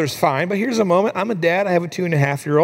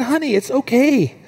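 put-on high-pitched soothing of a small child; acted parent lifting the voice to comfort; reassuring dad; 9 semitones higher than usual. Dad to his ashamed little girl, reassuring.

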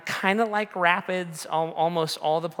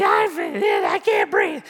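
high-pitched mock screaming; hammed-up panicked cry; freaking out; 11 semitones higher, 4 dB louder, much slower than usual. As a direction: imitate a drowning child in hysterics. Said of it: "kinda just freaking out".

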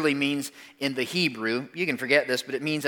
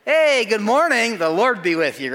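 bright, higher, louder everyday "Hey, good morning"; casual boss greeting; 7 semitones higher, 5 dB louder than usual. Retold or imitated. Boss greeting workers at the start of the day, breezy and loud. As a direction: toss it off like a boss greeting staff on Monday. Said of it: "That's how they greet you".

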